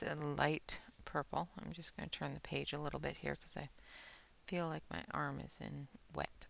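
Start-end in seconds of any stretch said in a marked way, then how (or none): none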